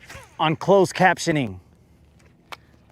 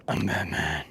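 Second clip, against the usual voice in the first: low, soft, breathy Batman voice; Batman whisper; 9 semitones lower, 9 dB quieter than usual. Retold or imitated. Ranting to viewers, low breathy Batman voice. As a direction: breathe it like the caped crusader. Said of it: "But I'm telling you guys".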